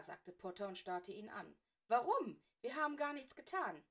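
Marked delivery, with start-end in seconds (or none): 1.9-3.8 put-on louder, higher outraged boy; schoolboy outraged at the teacher; indignant protest. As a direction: protest the injustice. Potter protests unfair punishment.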